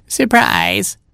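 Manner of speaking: put-on high, chatty old granny; cat posing as grandma. Cat makes excuses to Robin as grandma, high mock-granny voice. Cat covers up his visible tail.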